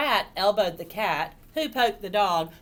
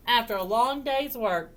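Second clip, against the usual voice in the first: ordinary delivery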